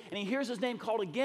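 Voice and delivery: ordinary talk, nothing marked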